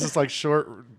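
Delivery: ordinary talk, nothing marked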